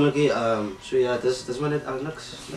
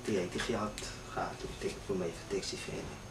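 sad and quiet; 8 semitones lower, 10 dB quieter than usual. Acted. Son tells of dead father.

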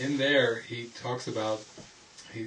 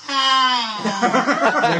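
shriek; 13 semitones higher, 13 dB louder than usual. Retold or imitated. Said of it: "She's like".

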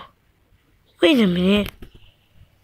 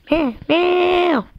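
cat meow; 7 semitones higher than usual. Acted. Cat meows after the fire is out.

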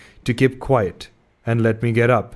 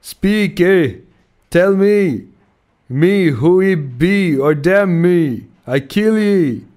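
loud, much higher, slow menacing threat; growled threat; 7 semitones higher, 5 dB louder, slower than usual. A narrator's reading. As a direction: demand an answer or else. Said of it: "again growled the cannibal".

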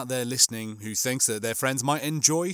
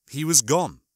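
plain reading voice

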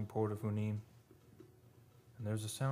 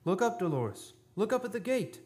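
urgent call; 10 semitones higher, 4 dB louder than usual. Acted. Ghostly Gunnar calls to Dolores.

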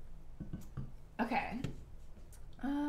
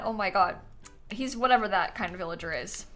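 ordinary delivery

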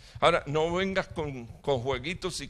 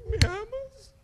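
very high, hammed-up pleading; mock needy plea; slower than usual. A needy believer asks the Lord, mock pleading. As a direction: beg for love in a squeaky high voice. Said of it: "en la oración agarran una rosa".

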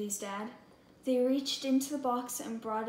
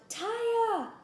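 high, stretched call for her sister; one last desperate call; yelling; 10 semitones higher, 4 dB louder than usual. Acted. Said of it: "Julie even yelled".

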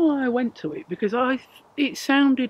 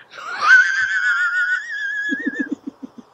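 long, helpless high-pitched laugh; shrieking giggle that keeps going; high squealing laugh; 4 dB louder than usual. Real, not acted. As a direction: burst into a drawn-out squeal of laughter. Cracks up after Patricia question.

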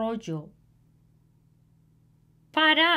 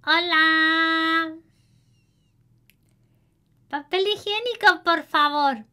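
put-on girl voice; 7 semitones higher, 3 dB louder, faster than usual. A narrator's reading. Girl answering and asking neighbor.

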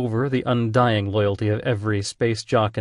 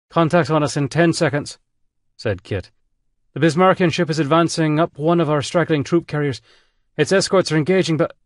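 urgent report; 7 semitones higher, 4 dB louder than usual. Acted. Kit reports incoming enemy.

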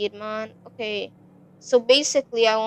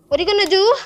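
defiant taunt; 6 semitones higher, 3 dB louder, faster than usual. Real, not acted. Taunting the monster.

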